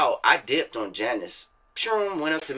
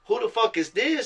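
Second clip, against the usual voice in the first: mock puzzled local; 8 semitones higher than usual. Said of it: "They like".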